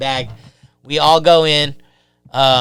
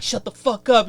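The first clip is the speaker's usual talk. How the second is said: angry inmates; 7 semitones higher than usual. Retold or imitated. Inmates yelling at crying kid.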